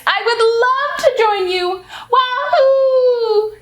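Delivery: delighted cheer rising to a whoop; excited little girl. Acted. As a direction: squeal it like a thrilled kid jumping in. Lucy invited to play.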